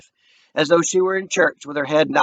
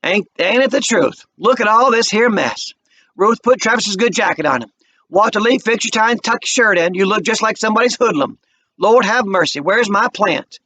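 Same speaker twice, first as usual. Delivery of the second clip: bustling Mama; 6 semitones higher than usual. A narrator's reading. Mama fussing over the family on moving day. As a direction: fuss over everyone, happy and busy.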